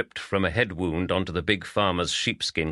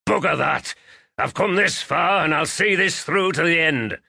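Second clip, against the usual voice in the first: higher, louder gruff refusal; stubborn farmer voice, fired up; defiant outburst; 9 semitones higher, 5 dB louder than usual. Acted. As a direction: dig in your heels and bark it. Wounded farmer refuses to stay.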